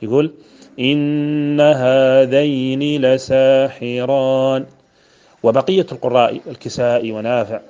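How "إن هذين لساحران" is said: slow, louder chant, إن held as "inna", هذين as "haadhayni"; doubled first noon. Shown as a demonstration. Teacher demonstrating Ibn Amir's reading, chanted with doubled first noon. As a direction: chant it slowly, holding the double n of إن. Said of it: "بتشديد النون الأولى".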